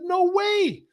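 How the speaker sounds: high-pitched mock disbelief; disbelieving. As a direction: exclaim it way up high, stunned. Retold disbelief at hearing "German accent.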